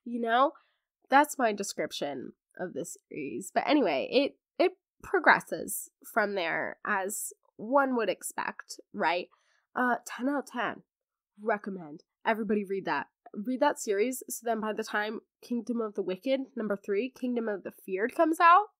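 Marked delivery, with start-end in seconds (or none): none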